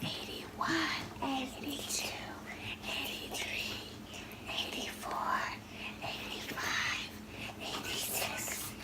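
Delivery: unvoiced, hushed counting; mother whispering the count with her child. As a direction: whisper the numbers like a secret. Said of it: "Oh, yes, we'll whisper".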